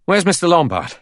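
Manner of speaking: high, loud, breathless question. A narrator's reading. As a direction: ask urgently, as if short of breath. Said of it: "Blore said breathlessly".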